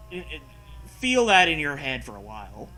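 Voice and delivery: ordinary delivery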